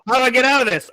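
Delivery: loud, playful alarm; mock panic. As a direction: play the cornered victim, loudly. Player acts threatened bear.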